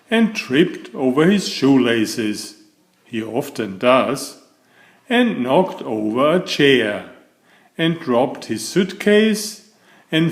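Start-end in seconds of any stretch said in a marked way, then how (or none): none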